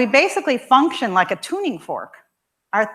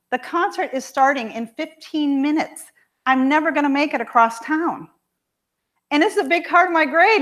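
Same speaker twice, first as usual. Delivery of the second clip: high, rising worried daughter, speeding up at the end; upset daughter; 6 semitones higher, 5 dB louder than usual. Retold or imitated. Daughter telling her mom she will be late, rising panic. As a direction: panic building toward the grade. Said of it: "she's all upset".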